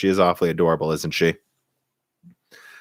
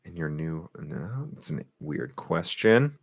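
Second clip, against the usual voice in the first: very quiet, half-voiced aside; reading to himself; 3 semitones lower, 9 dB quieter, slower than usual. Real, not acted. Streamer skimming chat questions, very quietly to himself. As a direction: mumble it while skimming a question.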